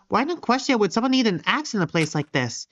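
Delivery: ordinary talk, nothing marked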